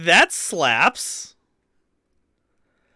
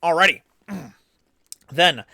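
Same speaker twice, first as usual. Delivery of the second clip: ordinary delivery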